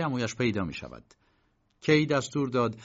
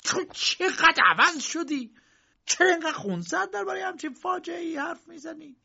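angry uncle. Acted. Uncle grabbing Josef's arm, angry and high. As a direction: scold his calm. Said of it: "با عصبانیت".

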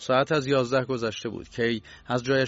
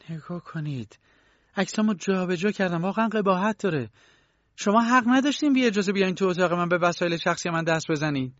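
rising, higher and louder indignant scolding; indignant outburst; 9 semitones higher, 4 dB louder than usual. Acted. Young woman scolding K for touching her photos, indignant and loud. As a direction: build from a hush to an outraged reprimand.

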